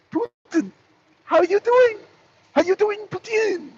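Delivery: high, chummy mock Macron; friendly mock Macron. Macron greeting Putin, high and chummy. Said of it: "I'm just reenacting the phone call".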